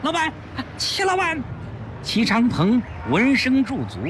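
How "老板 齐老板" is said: out of breath, calling high; breathless call. A narrator's reading. Young henchman running up to the boss, breathless call. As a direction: shout his name after running. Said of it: "他气喘吁吁地喊".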